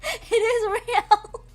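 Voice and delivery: high, loud, slow wail through laughter; hammed-up drawn-out insistence; mock-outraged insistence. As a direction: stretch it into a laughing protest.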